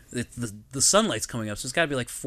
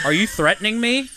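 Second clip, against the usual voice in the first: raised, louder challenge; mock indignant cop; 6 semitones higher, 5 dB louder than usual. Cop challenging a drunk rugby player, mock indignant. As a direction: challenge him, pitched up and loud.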